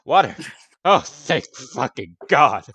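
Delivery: loud, joyful outburst; relieved shout. Acted. NPC relieved a player orders water, shouting thanks. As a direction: cry out in relief.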